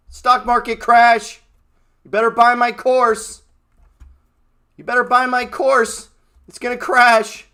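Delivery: high, loud, slow doom-preacher parody; mocking Robert Kiyosaki. Trader parodying a guru who sells a course, loud mock doom. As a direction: ham up a guru selling panic. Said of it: "Robert Kiyosaki's a complete moron".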